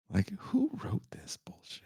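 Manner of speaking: breathy unvoiced aside; whisper. Real, not acted. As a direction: hiss it to the camera in disbelief. Aside mocking the movie script.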